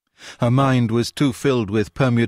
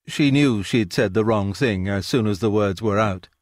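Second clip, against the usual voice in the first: ordinary delivery, nothing marked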